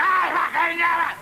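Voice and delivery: mock road-rage shout, drawn out; hammed-up angry yell. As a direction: bellow it like a furious driver. Mimes aggressive car gesture.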